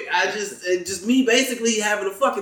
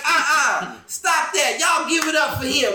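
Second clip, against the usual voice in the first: imitated woman heckler; 8 semitones higher than usual. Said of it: "And she was like".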